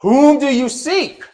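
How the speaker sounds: high, slow called-out question; calling out. Retold or imitated. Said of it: "Jesus calls out".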